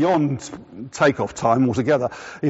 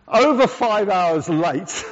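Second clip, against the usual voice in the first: laughing; 5 semitones higher, much slower than usual. Real, not acted. Laughing at the absurd delay.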